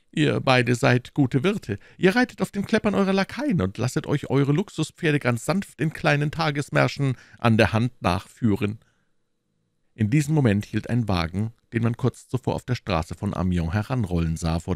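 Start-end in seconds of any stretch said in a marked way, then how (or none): 0.1-8.8 higher-pitched musketeer voice, lively; animated storybook musketeer; chiding musketeer. Acted. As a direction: chide your friends as a musketeer.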